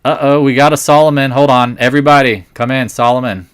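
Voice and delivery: excited call-out. Real, not acted. Calling a viewer up to speak.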